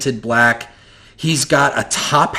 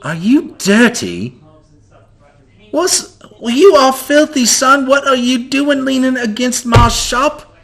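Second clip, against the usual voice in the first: scolding shopkeeper; 12 semitones higher than usual. Acted. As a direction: berate the filthy boy at his shop. GM voices Mikas scolding the boy.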